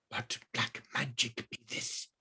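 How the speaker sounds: whispered in horror; horrified whisper. Acted. Wizard staring at the phone screen, whispering in horror. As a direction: breathe it in terror. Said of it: "he whispered in horror".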